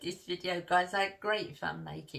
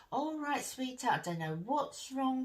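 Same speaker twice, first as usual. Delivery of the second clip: imitating mum's kindly voice, higher; 7 semitones higher than usual. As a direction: soothe like a mum on the phone. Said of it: "you know, that kindly voice".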